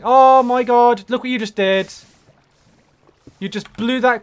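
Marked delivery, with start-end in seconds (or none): 0.0-1.9 outraged shout. Real, not acted. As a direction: drag it out in real outrage. Creeper blew up his stuff.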